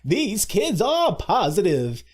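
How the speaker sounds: hammed-up melodramatic angel, slow and high; righteous indignation. Old angel scolding the other angels, slow overacted righteous indignation. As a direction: chew the scenery on each drawn-out word. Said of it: "shaky voice and righteous indignation".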